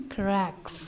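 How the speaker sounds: CRACKS said singly as a clear model word; model pronunciation. Shown as a demonstration. Teacher has students pronounce with her, modelling CRACKS. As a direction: say "cracks" carefully for learners. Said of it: "Pronounce with me".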